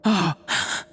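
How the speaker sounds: shocked gasp. Acted. Finds the jar empty.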